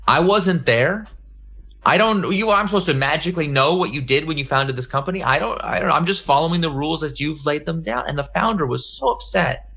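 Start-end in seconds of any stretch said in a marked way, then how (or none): 0.1-8.0 higher, pleading voice of a flustered employee; defensive whine. Retold or imitated. Product manager pleading with the upset founder, high defensive whine. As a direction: protest that it isn't your fault, voice up high. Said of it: "the poor product manager was like".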